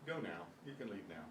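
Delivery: quiet, low-key dismissal; not harsh. Shown as a demonstration. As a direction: gently let them go. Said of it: "It doesn't have to be the harsh".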